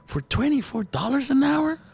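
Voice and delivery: high, disbelieving question; incredulous. Retold or imitated. Patient questioning the nurse's hourly pay, incredulous.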